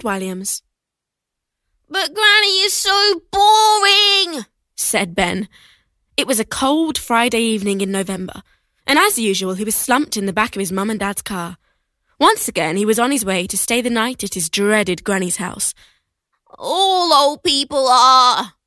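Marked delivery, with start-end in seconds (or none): 1.9-4.5 put-on high, slow sulky boy; whiny boy voice. Ben complains about granny. 16.6-18.5 put-on whiny boy voice. Ben continues complaining.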